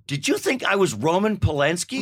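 high, indignant mock question; acted-out incredulity, pitched well up; incredulous challenge. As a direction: confront a stranger in disbelief. Acted confrontation with a mistaken stranger.

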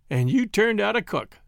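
usual speaking voice

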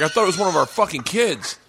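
high-pitched exasperated rant; pissed off. Retold or imitated. Husband scolds his crying wife over the cat, retold angry outburst. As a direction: re-enact an outraged telling-off. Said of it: "oh, I was so pissed off".

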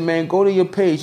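high urgent friend voice; imitating friend. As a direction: pitch up, push him on. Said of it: "He said".

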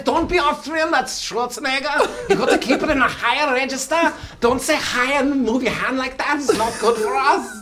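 high, loud mock German accent; a goofy German accent that keeps creeping up high.

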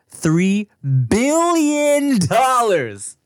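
drawn-out, rising hype on billion; mock announcer hype; hyped announcement. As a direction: stretch it slowly like a big reveal. Hyping a huge sale.

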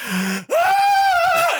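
long, very high scream of fright; reenacted scream. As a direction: let out a comic high-pitched shriek. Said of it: "And I scream".

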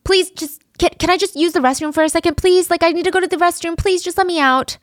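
high, fast desperate pleading; begging. Retold or imitated. Trapped teen begs his captors for the restroom, fast high pleading. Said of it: "he starts begging".

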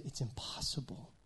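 whispered, falling on impossible; breathy whisper with "impossible" stressed and falling; hushed emphasis. Shown as a demonstration. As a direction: drop to a whisper on "impossible" to make it land. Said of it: "Not just, it's really hard".

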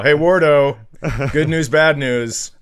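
ordinary talk, nothing marked

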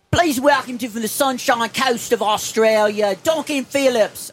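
high, slow Australian announcer; Sunshine Coast Australian accent. Retold or imitated. Comedian imitating an Australian concert host, slow Australian accent. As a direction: announce it like an Australian MC introducing the band. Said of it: "I'm doing more like Sunshine Coast".

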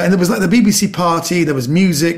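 usual speaking voice

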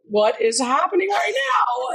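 high, slowed disbelief; mock-baffled cry; bewildered high exclaim. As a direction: ask it in stunned confusion. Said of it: "Poor [name] was just like".

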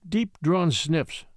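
ordinary delivery, nothing marked

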